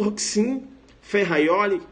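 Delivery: "Roxin" as HOK-sin, "Ferraioli" with a Y glide; confident correct pronunciation. Shown as a demonstration. Teacher acts a student's oral exam answer, correct pronunciation. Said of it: "Agora quando você começa".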